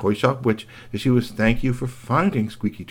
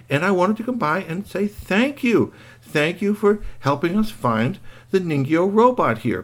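lightly raised, friendly character voice; mild character voice; 7 semitones higher than usual. Acted. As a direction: thank her sweetly in a slightly lifted voice. Character thanks the shop witch.

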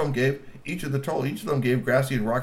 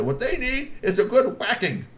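cranky granny; 12 semitones higher, 3 dB louder than usual. Acted. Granny Glub stands up.

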